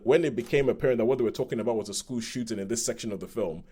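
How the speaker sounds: usual speaking voice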